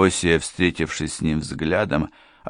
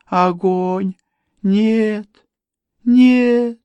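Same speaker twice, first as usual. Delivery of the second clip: high-pitched, building to a loud wail; terrified madman; 13 semitones higher, 4 dB louder, slower than usual. A narrator's reading. Madman sees a red devil, high terrified cries. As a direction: cry out the vision in terror.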